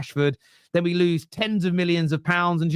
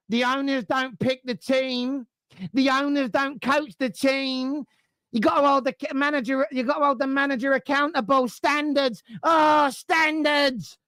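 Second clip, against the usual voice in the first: mocking fools; 6 semitones higher than usual. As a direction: parody pompous fans preaching standards. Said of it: "the same fools will say".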